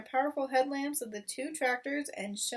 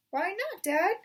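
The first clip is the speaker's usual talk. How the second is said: put-on high, louder child protest; cubs protesting; 10 semitones higher, 7 dB louder than usual. Cubs protesting to father, high and loud. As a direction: object like disappointed kids.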